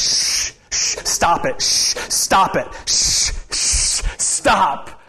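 put-on hushed urgent shushing at kids; sharp whispered stop it; whisper yelling. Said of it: "I'm guilty of this with my kids".

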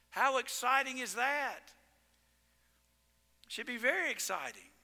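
ordinary talk, nothing marked

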